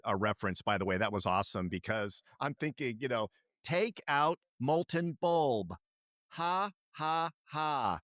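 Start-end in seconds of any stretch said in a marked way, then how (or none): 3.6-8.0 slow, hammed-up announcer with laugh; mock Operation ad; commercial voice. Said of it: "from the commercial".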